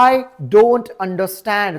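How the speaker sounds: very slow, spaced-out model sentence; slow teaching model. Shown as a demonstration. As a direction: say each word slowly for learners. (शिकवण्यासाठी अगदी सावकाश)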